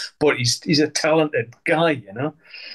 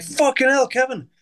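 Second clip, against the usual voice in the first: retold surprised exclamation; 9 semitones higher than usual. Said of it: "I was like".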